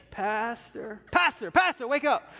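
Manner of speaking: sick man yelling for the pastor; urgent calling. Retold or imitated. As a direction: wake him up in a panic.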